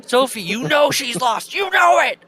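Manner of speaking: very high, loud accusation building to a shriek; hammed-up unhinged teacher screaming; manic mock shouting. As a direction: escalate into a hysterical yell. Imitating manic teacher yelling.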